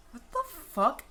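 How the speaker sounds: a high shocked cry of disbelief. Retold or imitated. Shocked high cry at the rotting man. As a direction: recoil in horror at the rotting face. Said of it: "And I was like".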